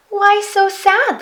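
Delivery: put-on high, bright-eyed child asking; a curious small girl checks on a sad creature; little girl voice. A narrator's reading. Little girl asks the Yeti what's wrong, high and curious.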